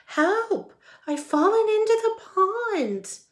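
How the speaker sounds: high, loud cry for help; calling for help. Acted. Wilbur calling for help from the pond, high and loud. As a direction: yell it out from far away.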